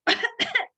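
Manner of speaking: a small theatrical cough; quick dry character cough; cricket's cough. Acted. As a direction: clear the throat like a little cricket. Cricket coughs before speaking.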